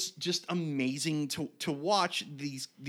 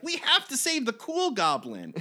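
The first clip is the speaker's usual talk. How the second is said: put-on high, louder childlike urgency; mock kid voice, higher and louder; eager kids; 13 semitones higher, 5 dB louder than usual. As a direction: play it like excited kids rallying. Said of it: "They're like".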